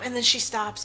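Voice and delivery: ordinary delivery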